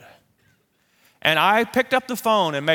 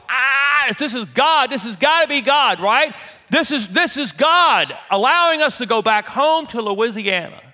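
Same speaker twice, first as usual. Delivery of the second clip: wife's thrilled high outburst; gushing mock wife voice; excited; 8 semitones higher, 4 dB louder than usual. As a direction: imitate Pam bursting with joy, louder and higher. Wife's thrilled reaction to offer.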